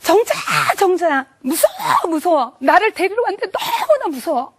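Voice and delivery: high, crying shout of a dying man in terror; terrified crying scream. Retold or imitated. Dying husband screaming for his wife, terrified crying shout. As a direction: cry out his wife's name, petrified. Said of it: "제가 그대로 해보겠습니다".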